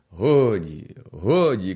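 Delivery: a loud, commanding shout that calls a halt. Acted. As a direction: bellow it at the crowd like a rebel leader. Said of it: "кричить гонта".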